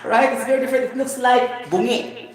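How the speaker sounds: laughing. Real, not acted.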